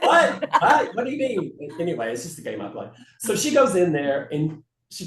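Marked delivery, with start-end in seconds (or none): 0.0-1.7 high, loud baffled outburst; laughing pretend shock; mock startled. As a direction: play the bewildered victim of a prank. Acting a caught-out passenger's startled reply.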